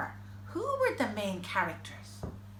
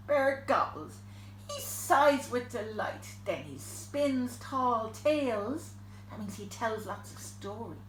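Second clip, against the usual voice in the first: ordinary delivery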